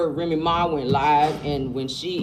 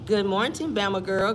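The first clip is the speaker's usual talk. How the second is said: usual speaking voice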